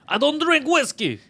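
high, drawn-out mock outrage; indignant refusal. Fighter turning down an offered whiskey, indignant. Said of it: "McGregor just offered him whiskey".

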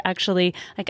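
normal talking voice

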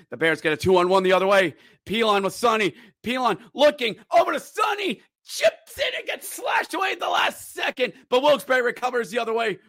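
fast, rising hockey call; live play-by-play. Real, not acted. Announcer calling a Bears two-on-one rush, excited.

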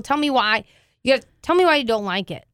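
ordinary talk

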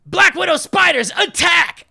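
villain command shout. Retold or imitated. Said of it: "And then he's like".

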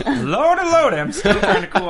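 high laughing fluster. Real, not acted. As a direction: laugh through the words.

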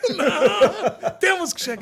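laughing. Real, not acted. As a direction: laugh through the reply. Laughing reply about reaching December.

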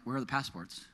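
soft, low question to yourself; retold muted first realization; quiet puzzlement. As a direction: wonder under your breath. Retold first puzzled search for passports.

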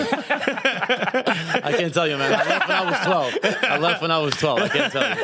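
cracking up mid-sentence; laughing. Real, not acted.

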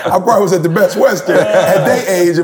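talking through laughter; laughing about his own past rooms. Real, not acted. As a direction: confess it while laughing. Laughing self-teasing aside.

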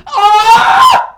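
a high, loud imitated scream; mock shriek. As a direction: shriek like a startled stranger. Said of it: "she just goes".

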